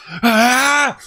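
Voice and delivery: high, very loud war cry; mock battle scream. Samurai attacking an outsider, a loud, high battle scream. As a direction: shriek like a samurai swinging a sword.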